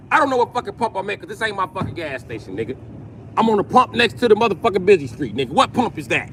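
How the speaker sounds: ordinary talk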